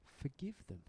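soft forgiving murmur; gentle dying words. Retold or imitated. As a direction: breathe it out like a dying man at peace. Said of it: "he uttered these words".

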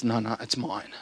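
soft, grasping claim of ownership; possessive mock voice. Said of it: "and it says".

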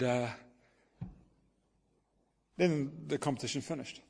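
normal talking voice

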